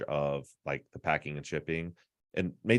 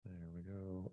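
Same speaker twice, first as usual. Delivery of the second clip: echoey; 17 dB quieter, slower than usual. Real, not acted. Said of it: "Why do I sound echoey?".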